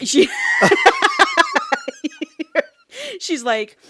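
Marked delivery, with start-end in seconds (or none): 0.3-2.7 real laugh breaking into a shout; cracking up loudly, high and hard; loud burst of laughter. Real, not acted. Laughing at her friend's joke, loud real laughter.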